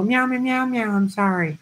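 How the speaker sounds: lightly voiced gentle kids' show host, high meows; a hint of a Mister Rogers character meowing an apology; mock sweet apology to the petting zoo animals; Mister Rogers-ish apology. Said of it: "like a Mister Rogers character".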